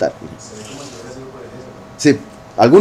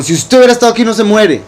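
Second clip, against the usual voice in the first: higher, accusing whine; mock reproach; 7 semitones higher, faster than usual. Miriam blaming Yahushua for her brother's death, mock reproachful. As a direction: blame him like a hurt sister.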